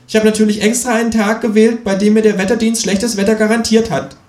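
high, emphatic creature voice; self-satisfied kangaroo. Acted. Kangaroo explaining its bad-weather choice, high and smug.

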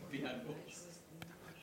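breathy whispered single word. Pretend. Listener whispering back the sausage name, very soft. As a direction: whisper it like a secret.

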